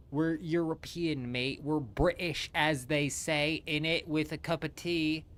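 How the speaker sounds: hammed-up jokey British bloke, tongue in cheek; lightly done British accent showing off to chat; mock British accent. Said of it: "That's a spot-on British accent".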